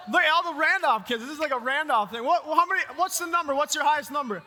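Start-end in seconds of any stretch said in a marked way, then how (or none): 0.1-1.2 loud, higher excited shout; calling out. Real, not acted. Pastor shouting out a family's kids in the crowd, excited. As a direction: point them out across the room.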